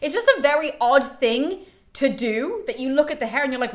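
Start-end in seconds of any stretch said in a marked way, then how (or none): none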